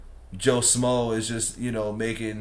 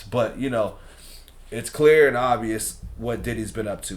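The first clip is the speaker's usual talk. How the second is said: ordinary talk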